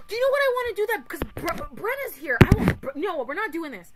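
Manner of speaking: fast, high and loud excitement; giddy sudden excitement; excited rush. Real, not acted. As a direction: blurt it out in a rush.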